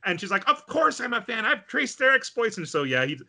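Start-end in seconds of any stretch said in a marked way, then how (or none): 0.5-2.6 high-pitched eager insistence; fervent fan. Retold or imitated. Super fan declaring devotion to her idols, fervently. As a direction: insist in a raised fangirl voice.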